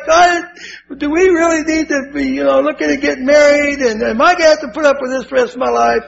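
high-pitched exasperated rant, speeding up at the end; upset wife. Retold or imitated. Wife questions marrying him after catching him, upset. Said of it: "Ooh, is she upset".

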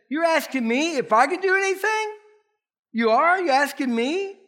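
high, emphatic echo questions; incredulous questioning. Acted. Jesus answers the doubting father, high incredulous questioning. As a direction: throw the question back in disbelief.

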